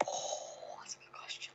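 whispered team conferring; whispering. Real, not acted. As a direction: whisper so the host can't hear. Conferring quietly with teammates.

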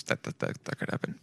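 faint voiceless whisper; whispered trailing admission. Real, not acted. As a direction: drop to a whisper as if confessing.

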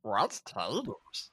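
slow, soft creature rasp; a low Gollum croak. Retold or imitated. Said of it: "It's Gollum".

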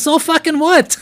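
high, mocking voice of the friend; dismissive friend. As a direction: brush it off, unimpressed. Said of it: "He goes, he goes".

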